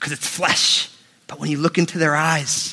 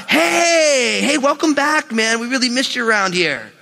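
sudden friendly welcome; 6 semitones higher than usual. Retold or imitated. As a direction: switch to fake joy, laughing. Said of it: "then he walks out".

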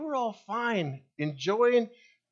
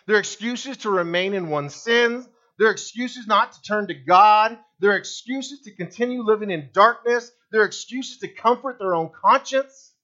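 louder with each line, hammering "They're"; building preaching; 5 dB louder than usual. Real, not acted. Preacher pressing his congregation, building to loud. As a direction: pile up the charges with rising force.

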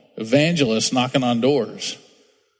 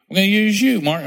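higher and louder, pressing the call home; insistent repeat; 7 semitones higher, 3 dB louder than usual. Acted. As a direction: raise it up and insist, calling Mark by name. God repeats, pressing Mark.

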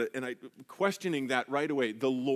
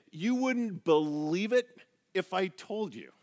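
higher, slow and knowing; voicing God; 5 semitones higher, slower than usual. Preacher voicing God to the church, slow and knowing. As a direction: let God say it with amused wonder. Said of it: "God's saying".

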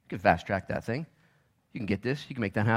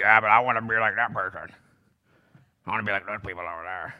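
mock envious complainer; 2 semitones lower than usual. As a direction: answer back in a sulky mock grumble. Mimics an envious complainer answering back.

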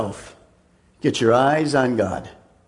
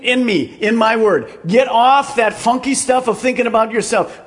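higher and louder, urging; fervent God voice; 8 semitones higher, 4 dB louder than usual. Acted. God urging Elijah back to work, fervently. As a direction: press it on him with fire.

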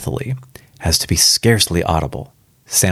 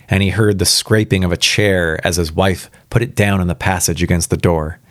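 ordinary delivery, nothing marked

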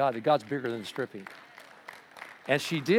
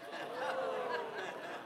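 high laugh; 10 dB quieter than usual. Real, not acted. Pastor laughing at his joke.

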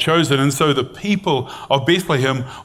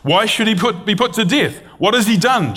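much higher, alarmed pleading; pleading son; 9 semitones higher than usual. A narrator's reading. Jonathan pleading with his father, high and urgent. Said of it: "Jonathan asked his father".

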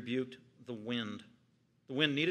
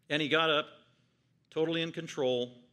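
normal talking voice

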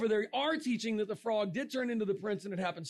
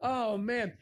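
usual speaking voice

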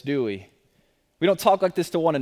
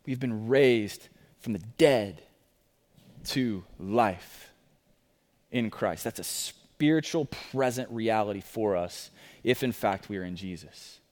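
soft, lower, intimate sermon voice; hushed preaching; 7 semitones lower, 7 dB quieter than usual. Acted. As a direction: draw them in quietly. Soft reflective sermon passage.

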